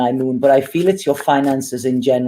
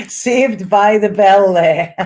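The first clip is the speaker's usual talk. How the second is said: slow, high words through real laughter; laughing; 6 semitones higher, 4 dB louder, slower than usual. Real, not acted. Reader relieved to reach the last one, laughing. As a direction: laugh it out loudly, drawn out.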